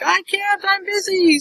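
high, sing-out reply; calling back. Retold or imitated. Mom calls back that she is busy, high imitated holler. Retelling Mom's called-back refusal.

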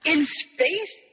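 high incredulous question; hammed-up amazed query; acted astonishment. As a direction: ask it high, in mock disbelief. Acted disbelief at found donuts.

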